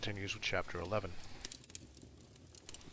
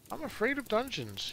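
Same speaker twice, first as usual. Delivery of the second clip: small voice; 12 semitones higher than usual. Acted. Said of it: "he said in a small voice".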